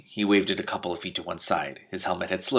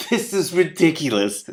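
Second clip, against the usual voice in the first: exasperated; 8 semitones higher, 5 dB louder than usual. A narrator's reading. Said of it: "said Shiara".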